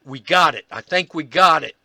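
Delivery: thrilled we got it shout, loud; excited. Retold or imitated. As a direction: announce the find like it's the big moment. Ron excited at capture.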